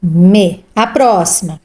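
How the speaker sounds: normal talking voice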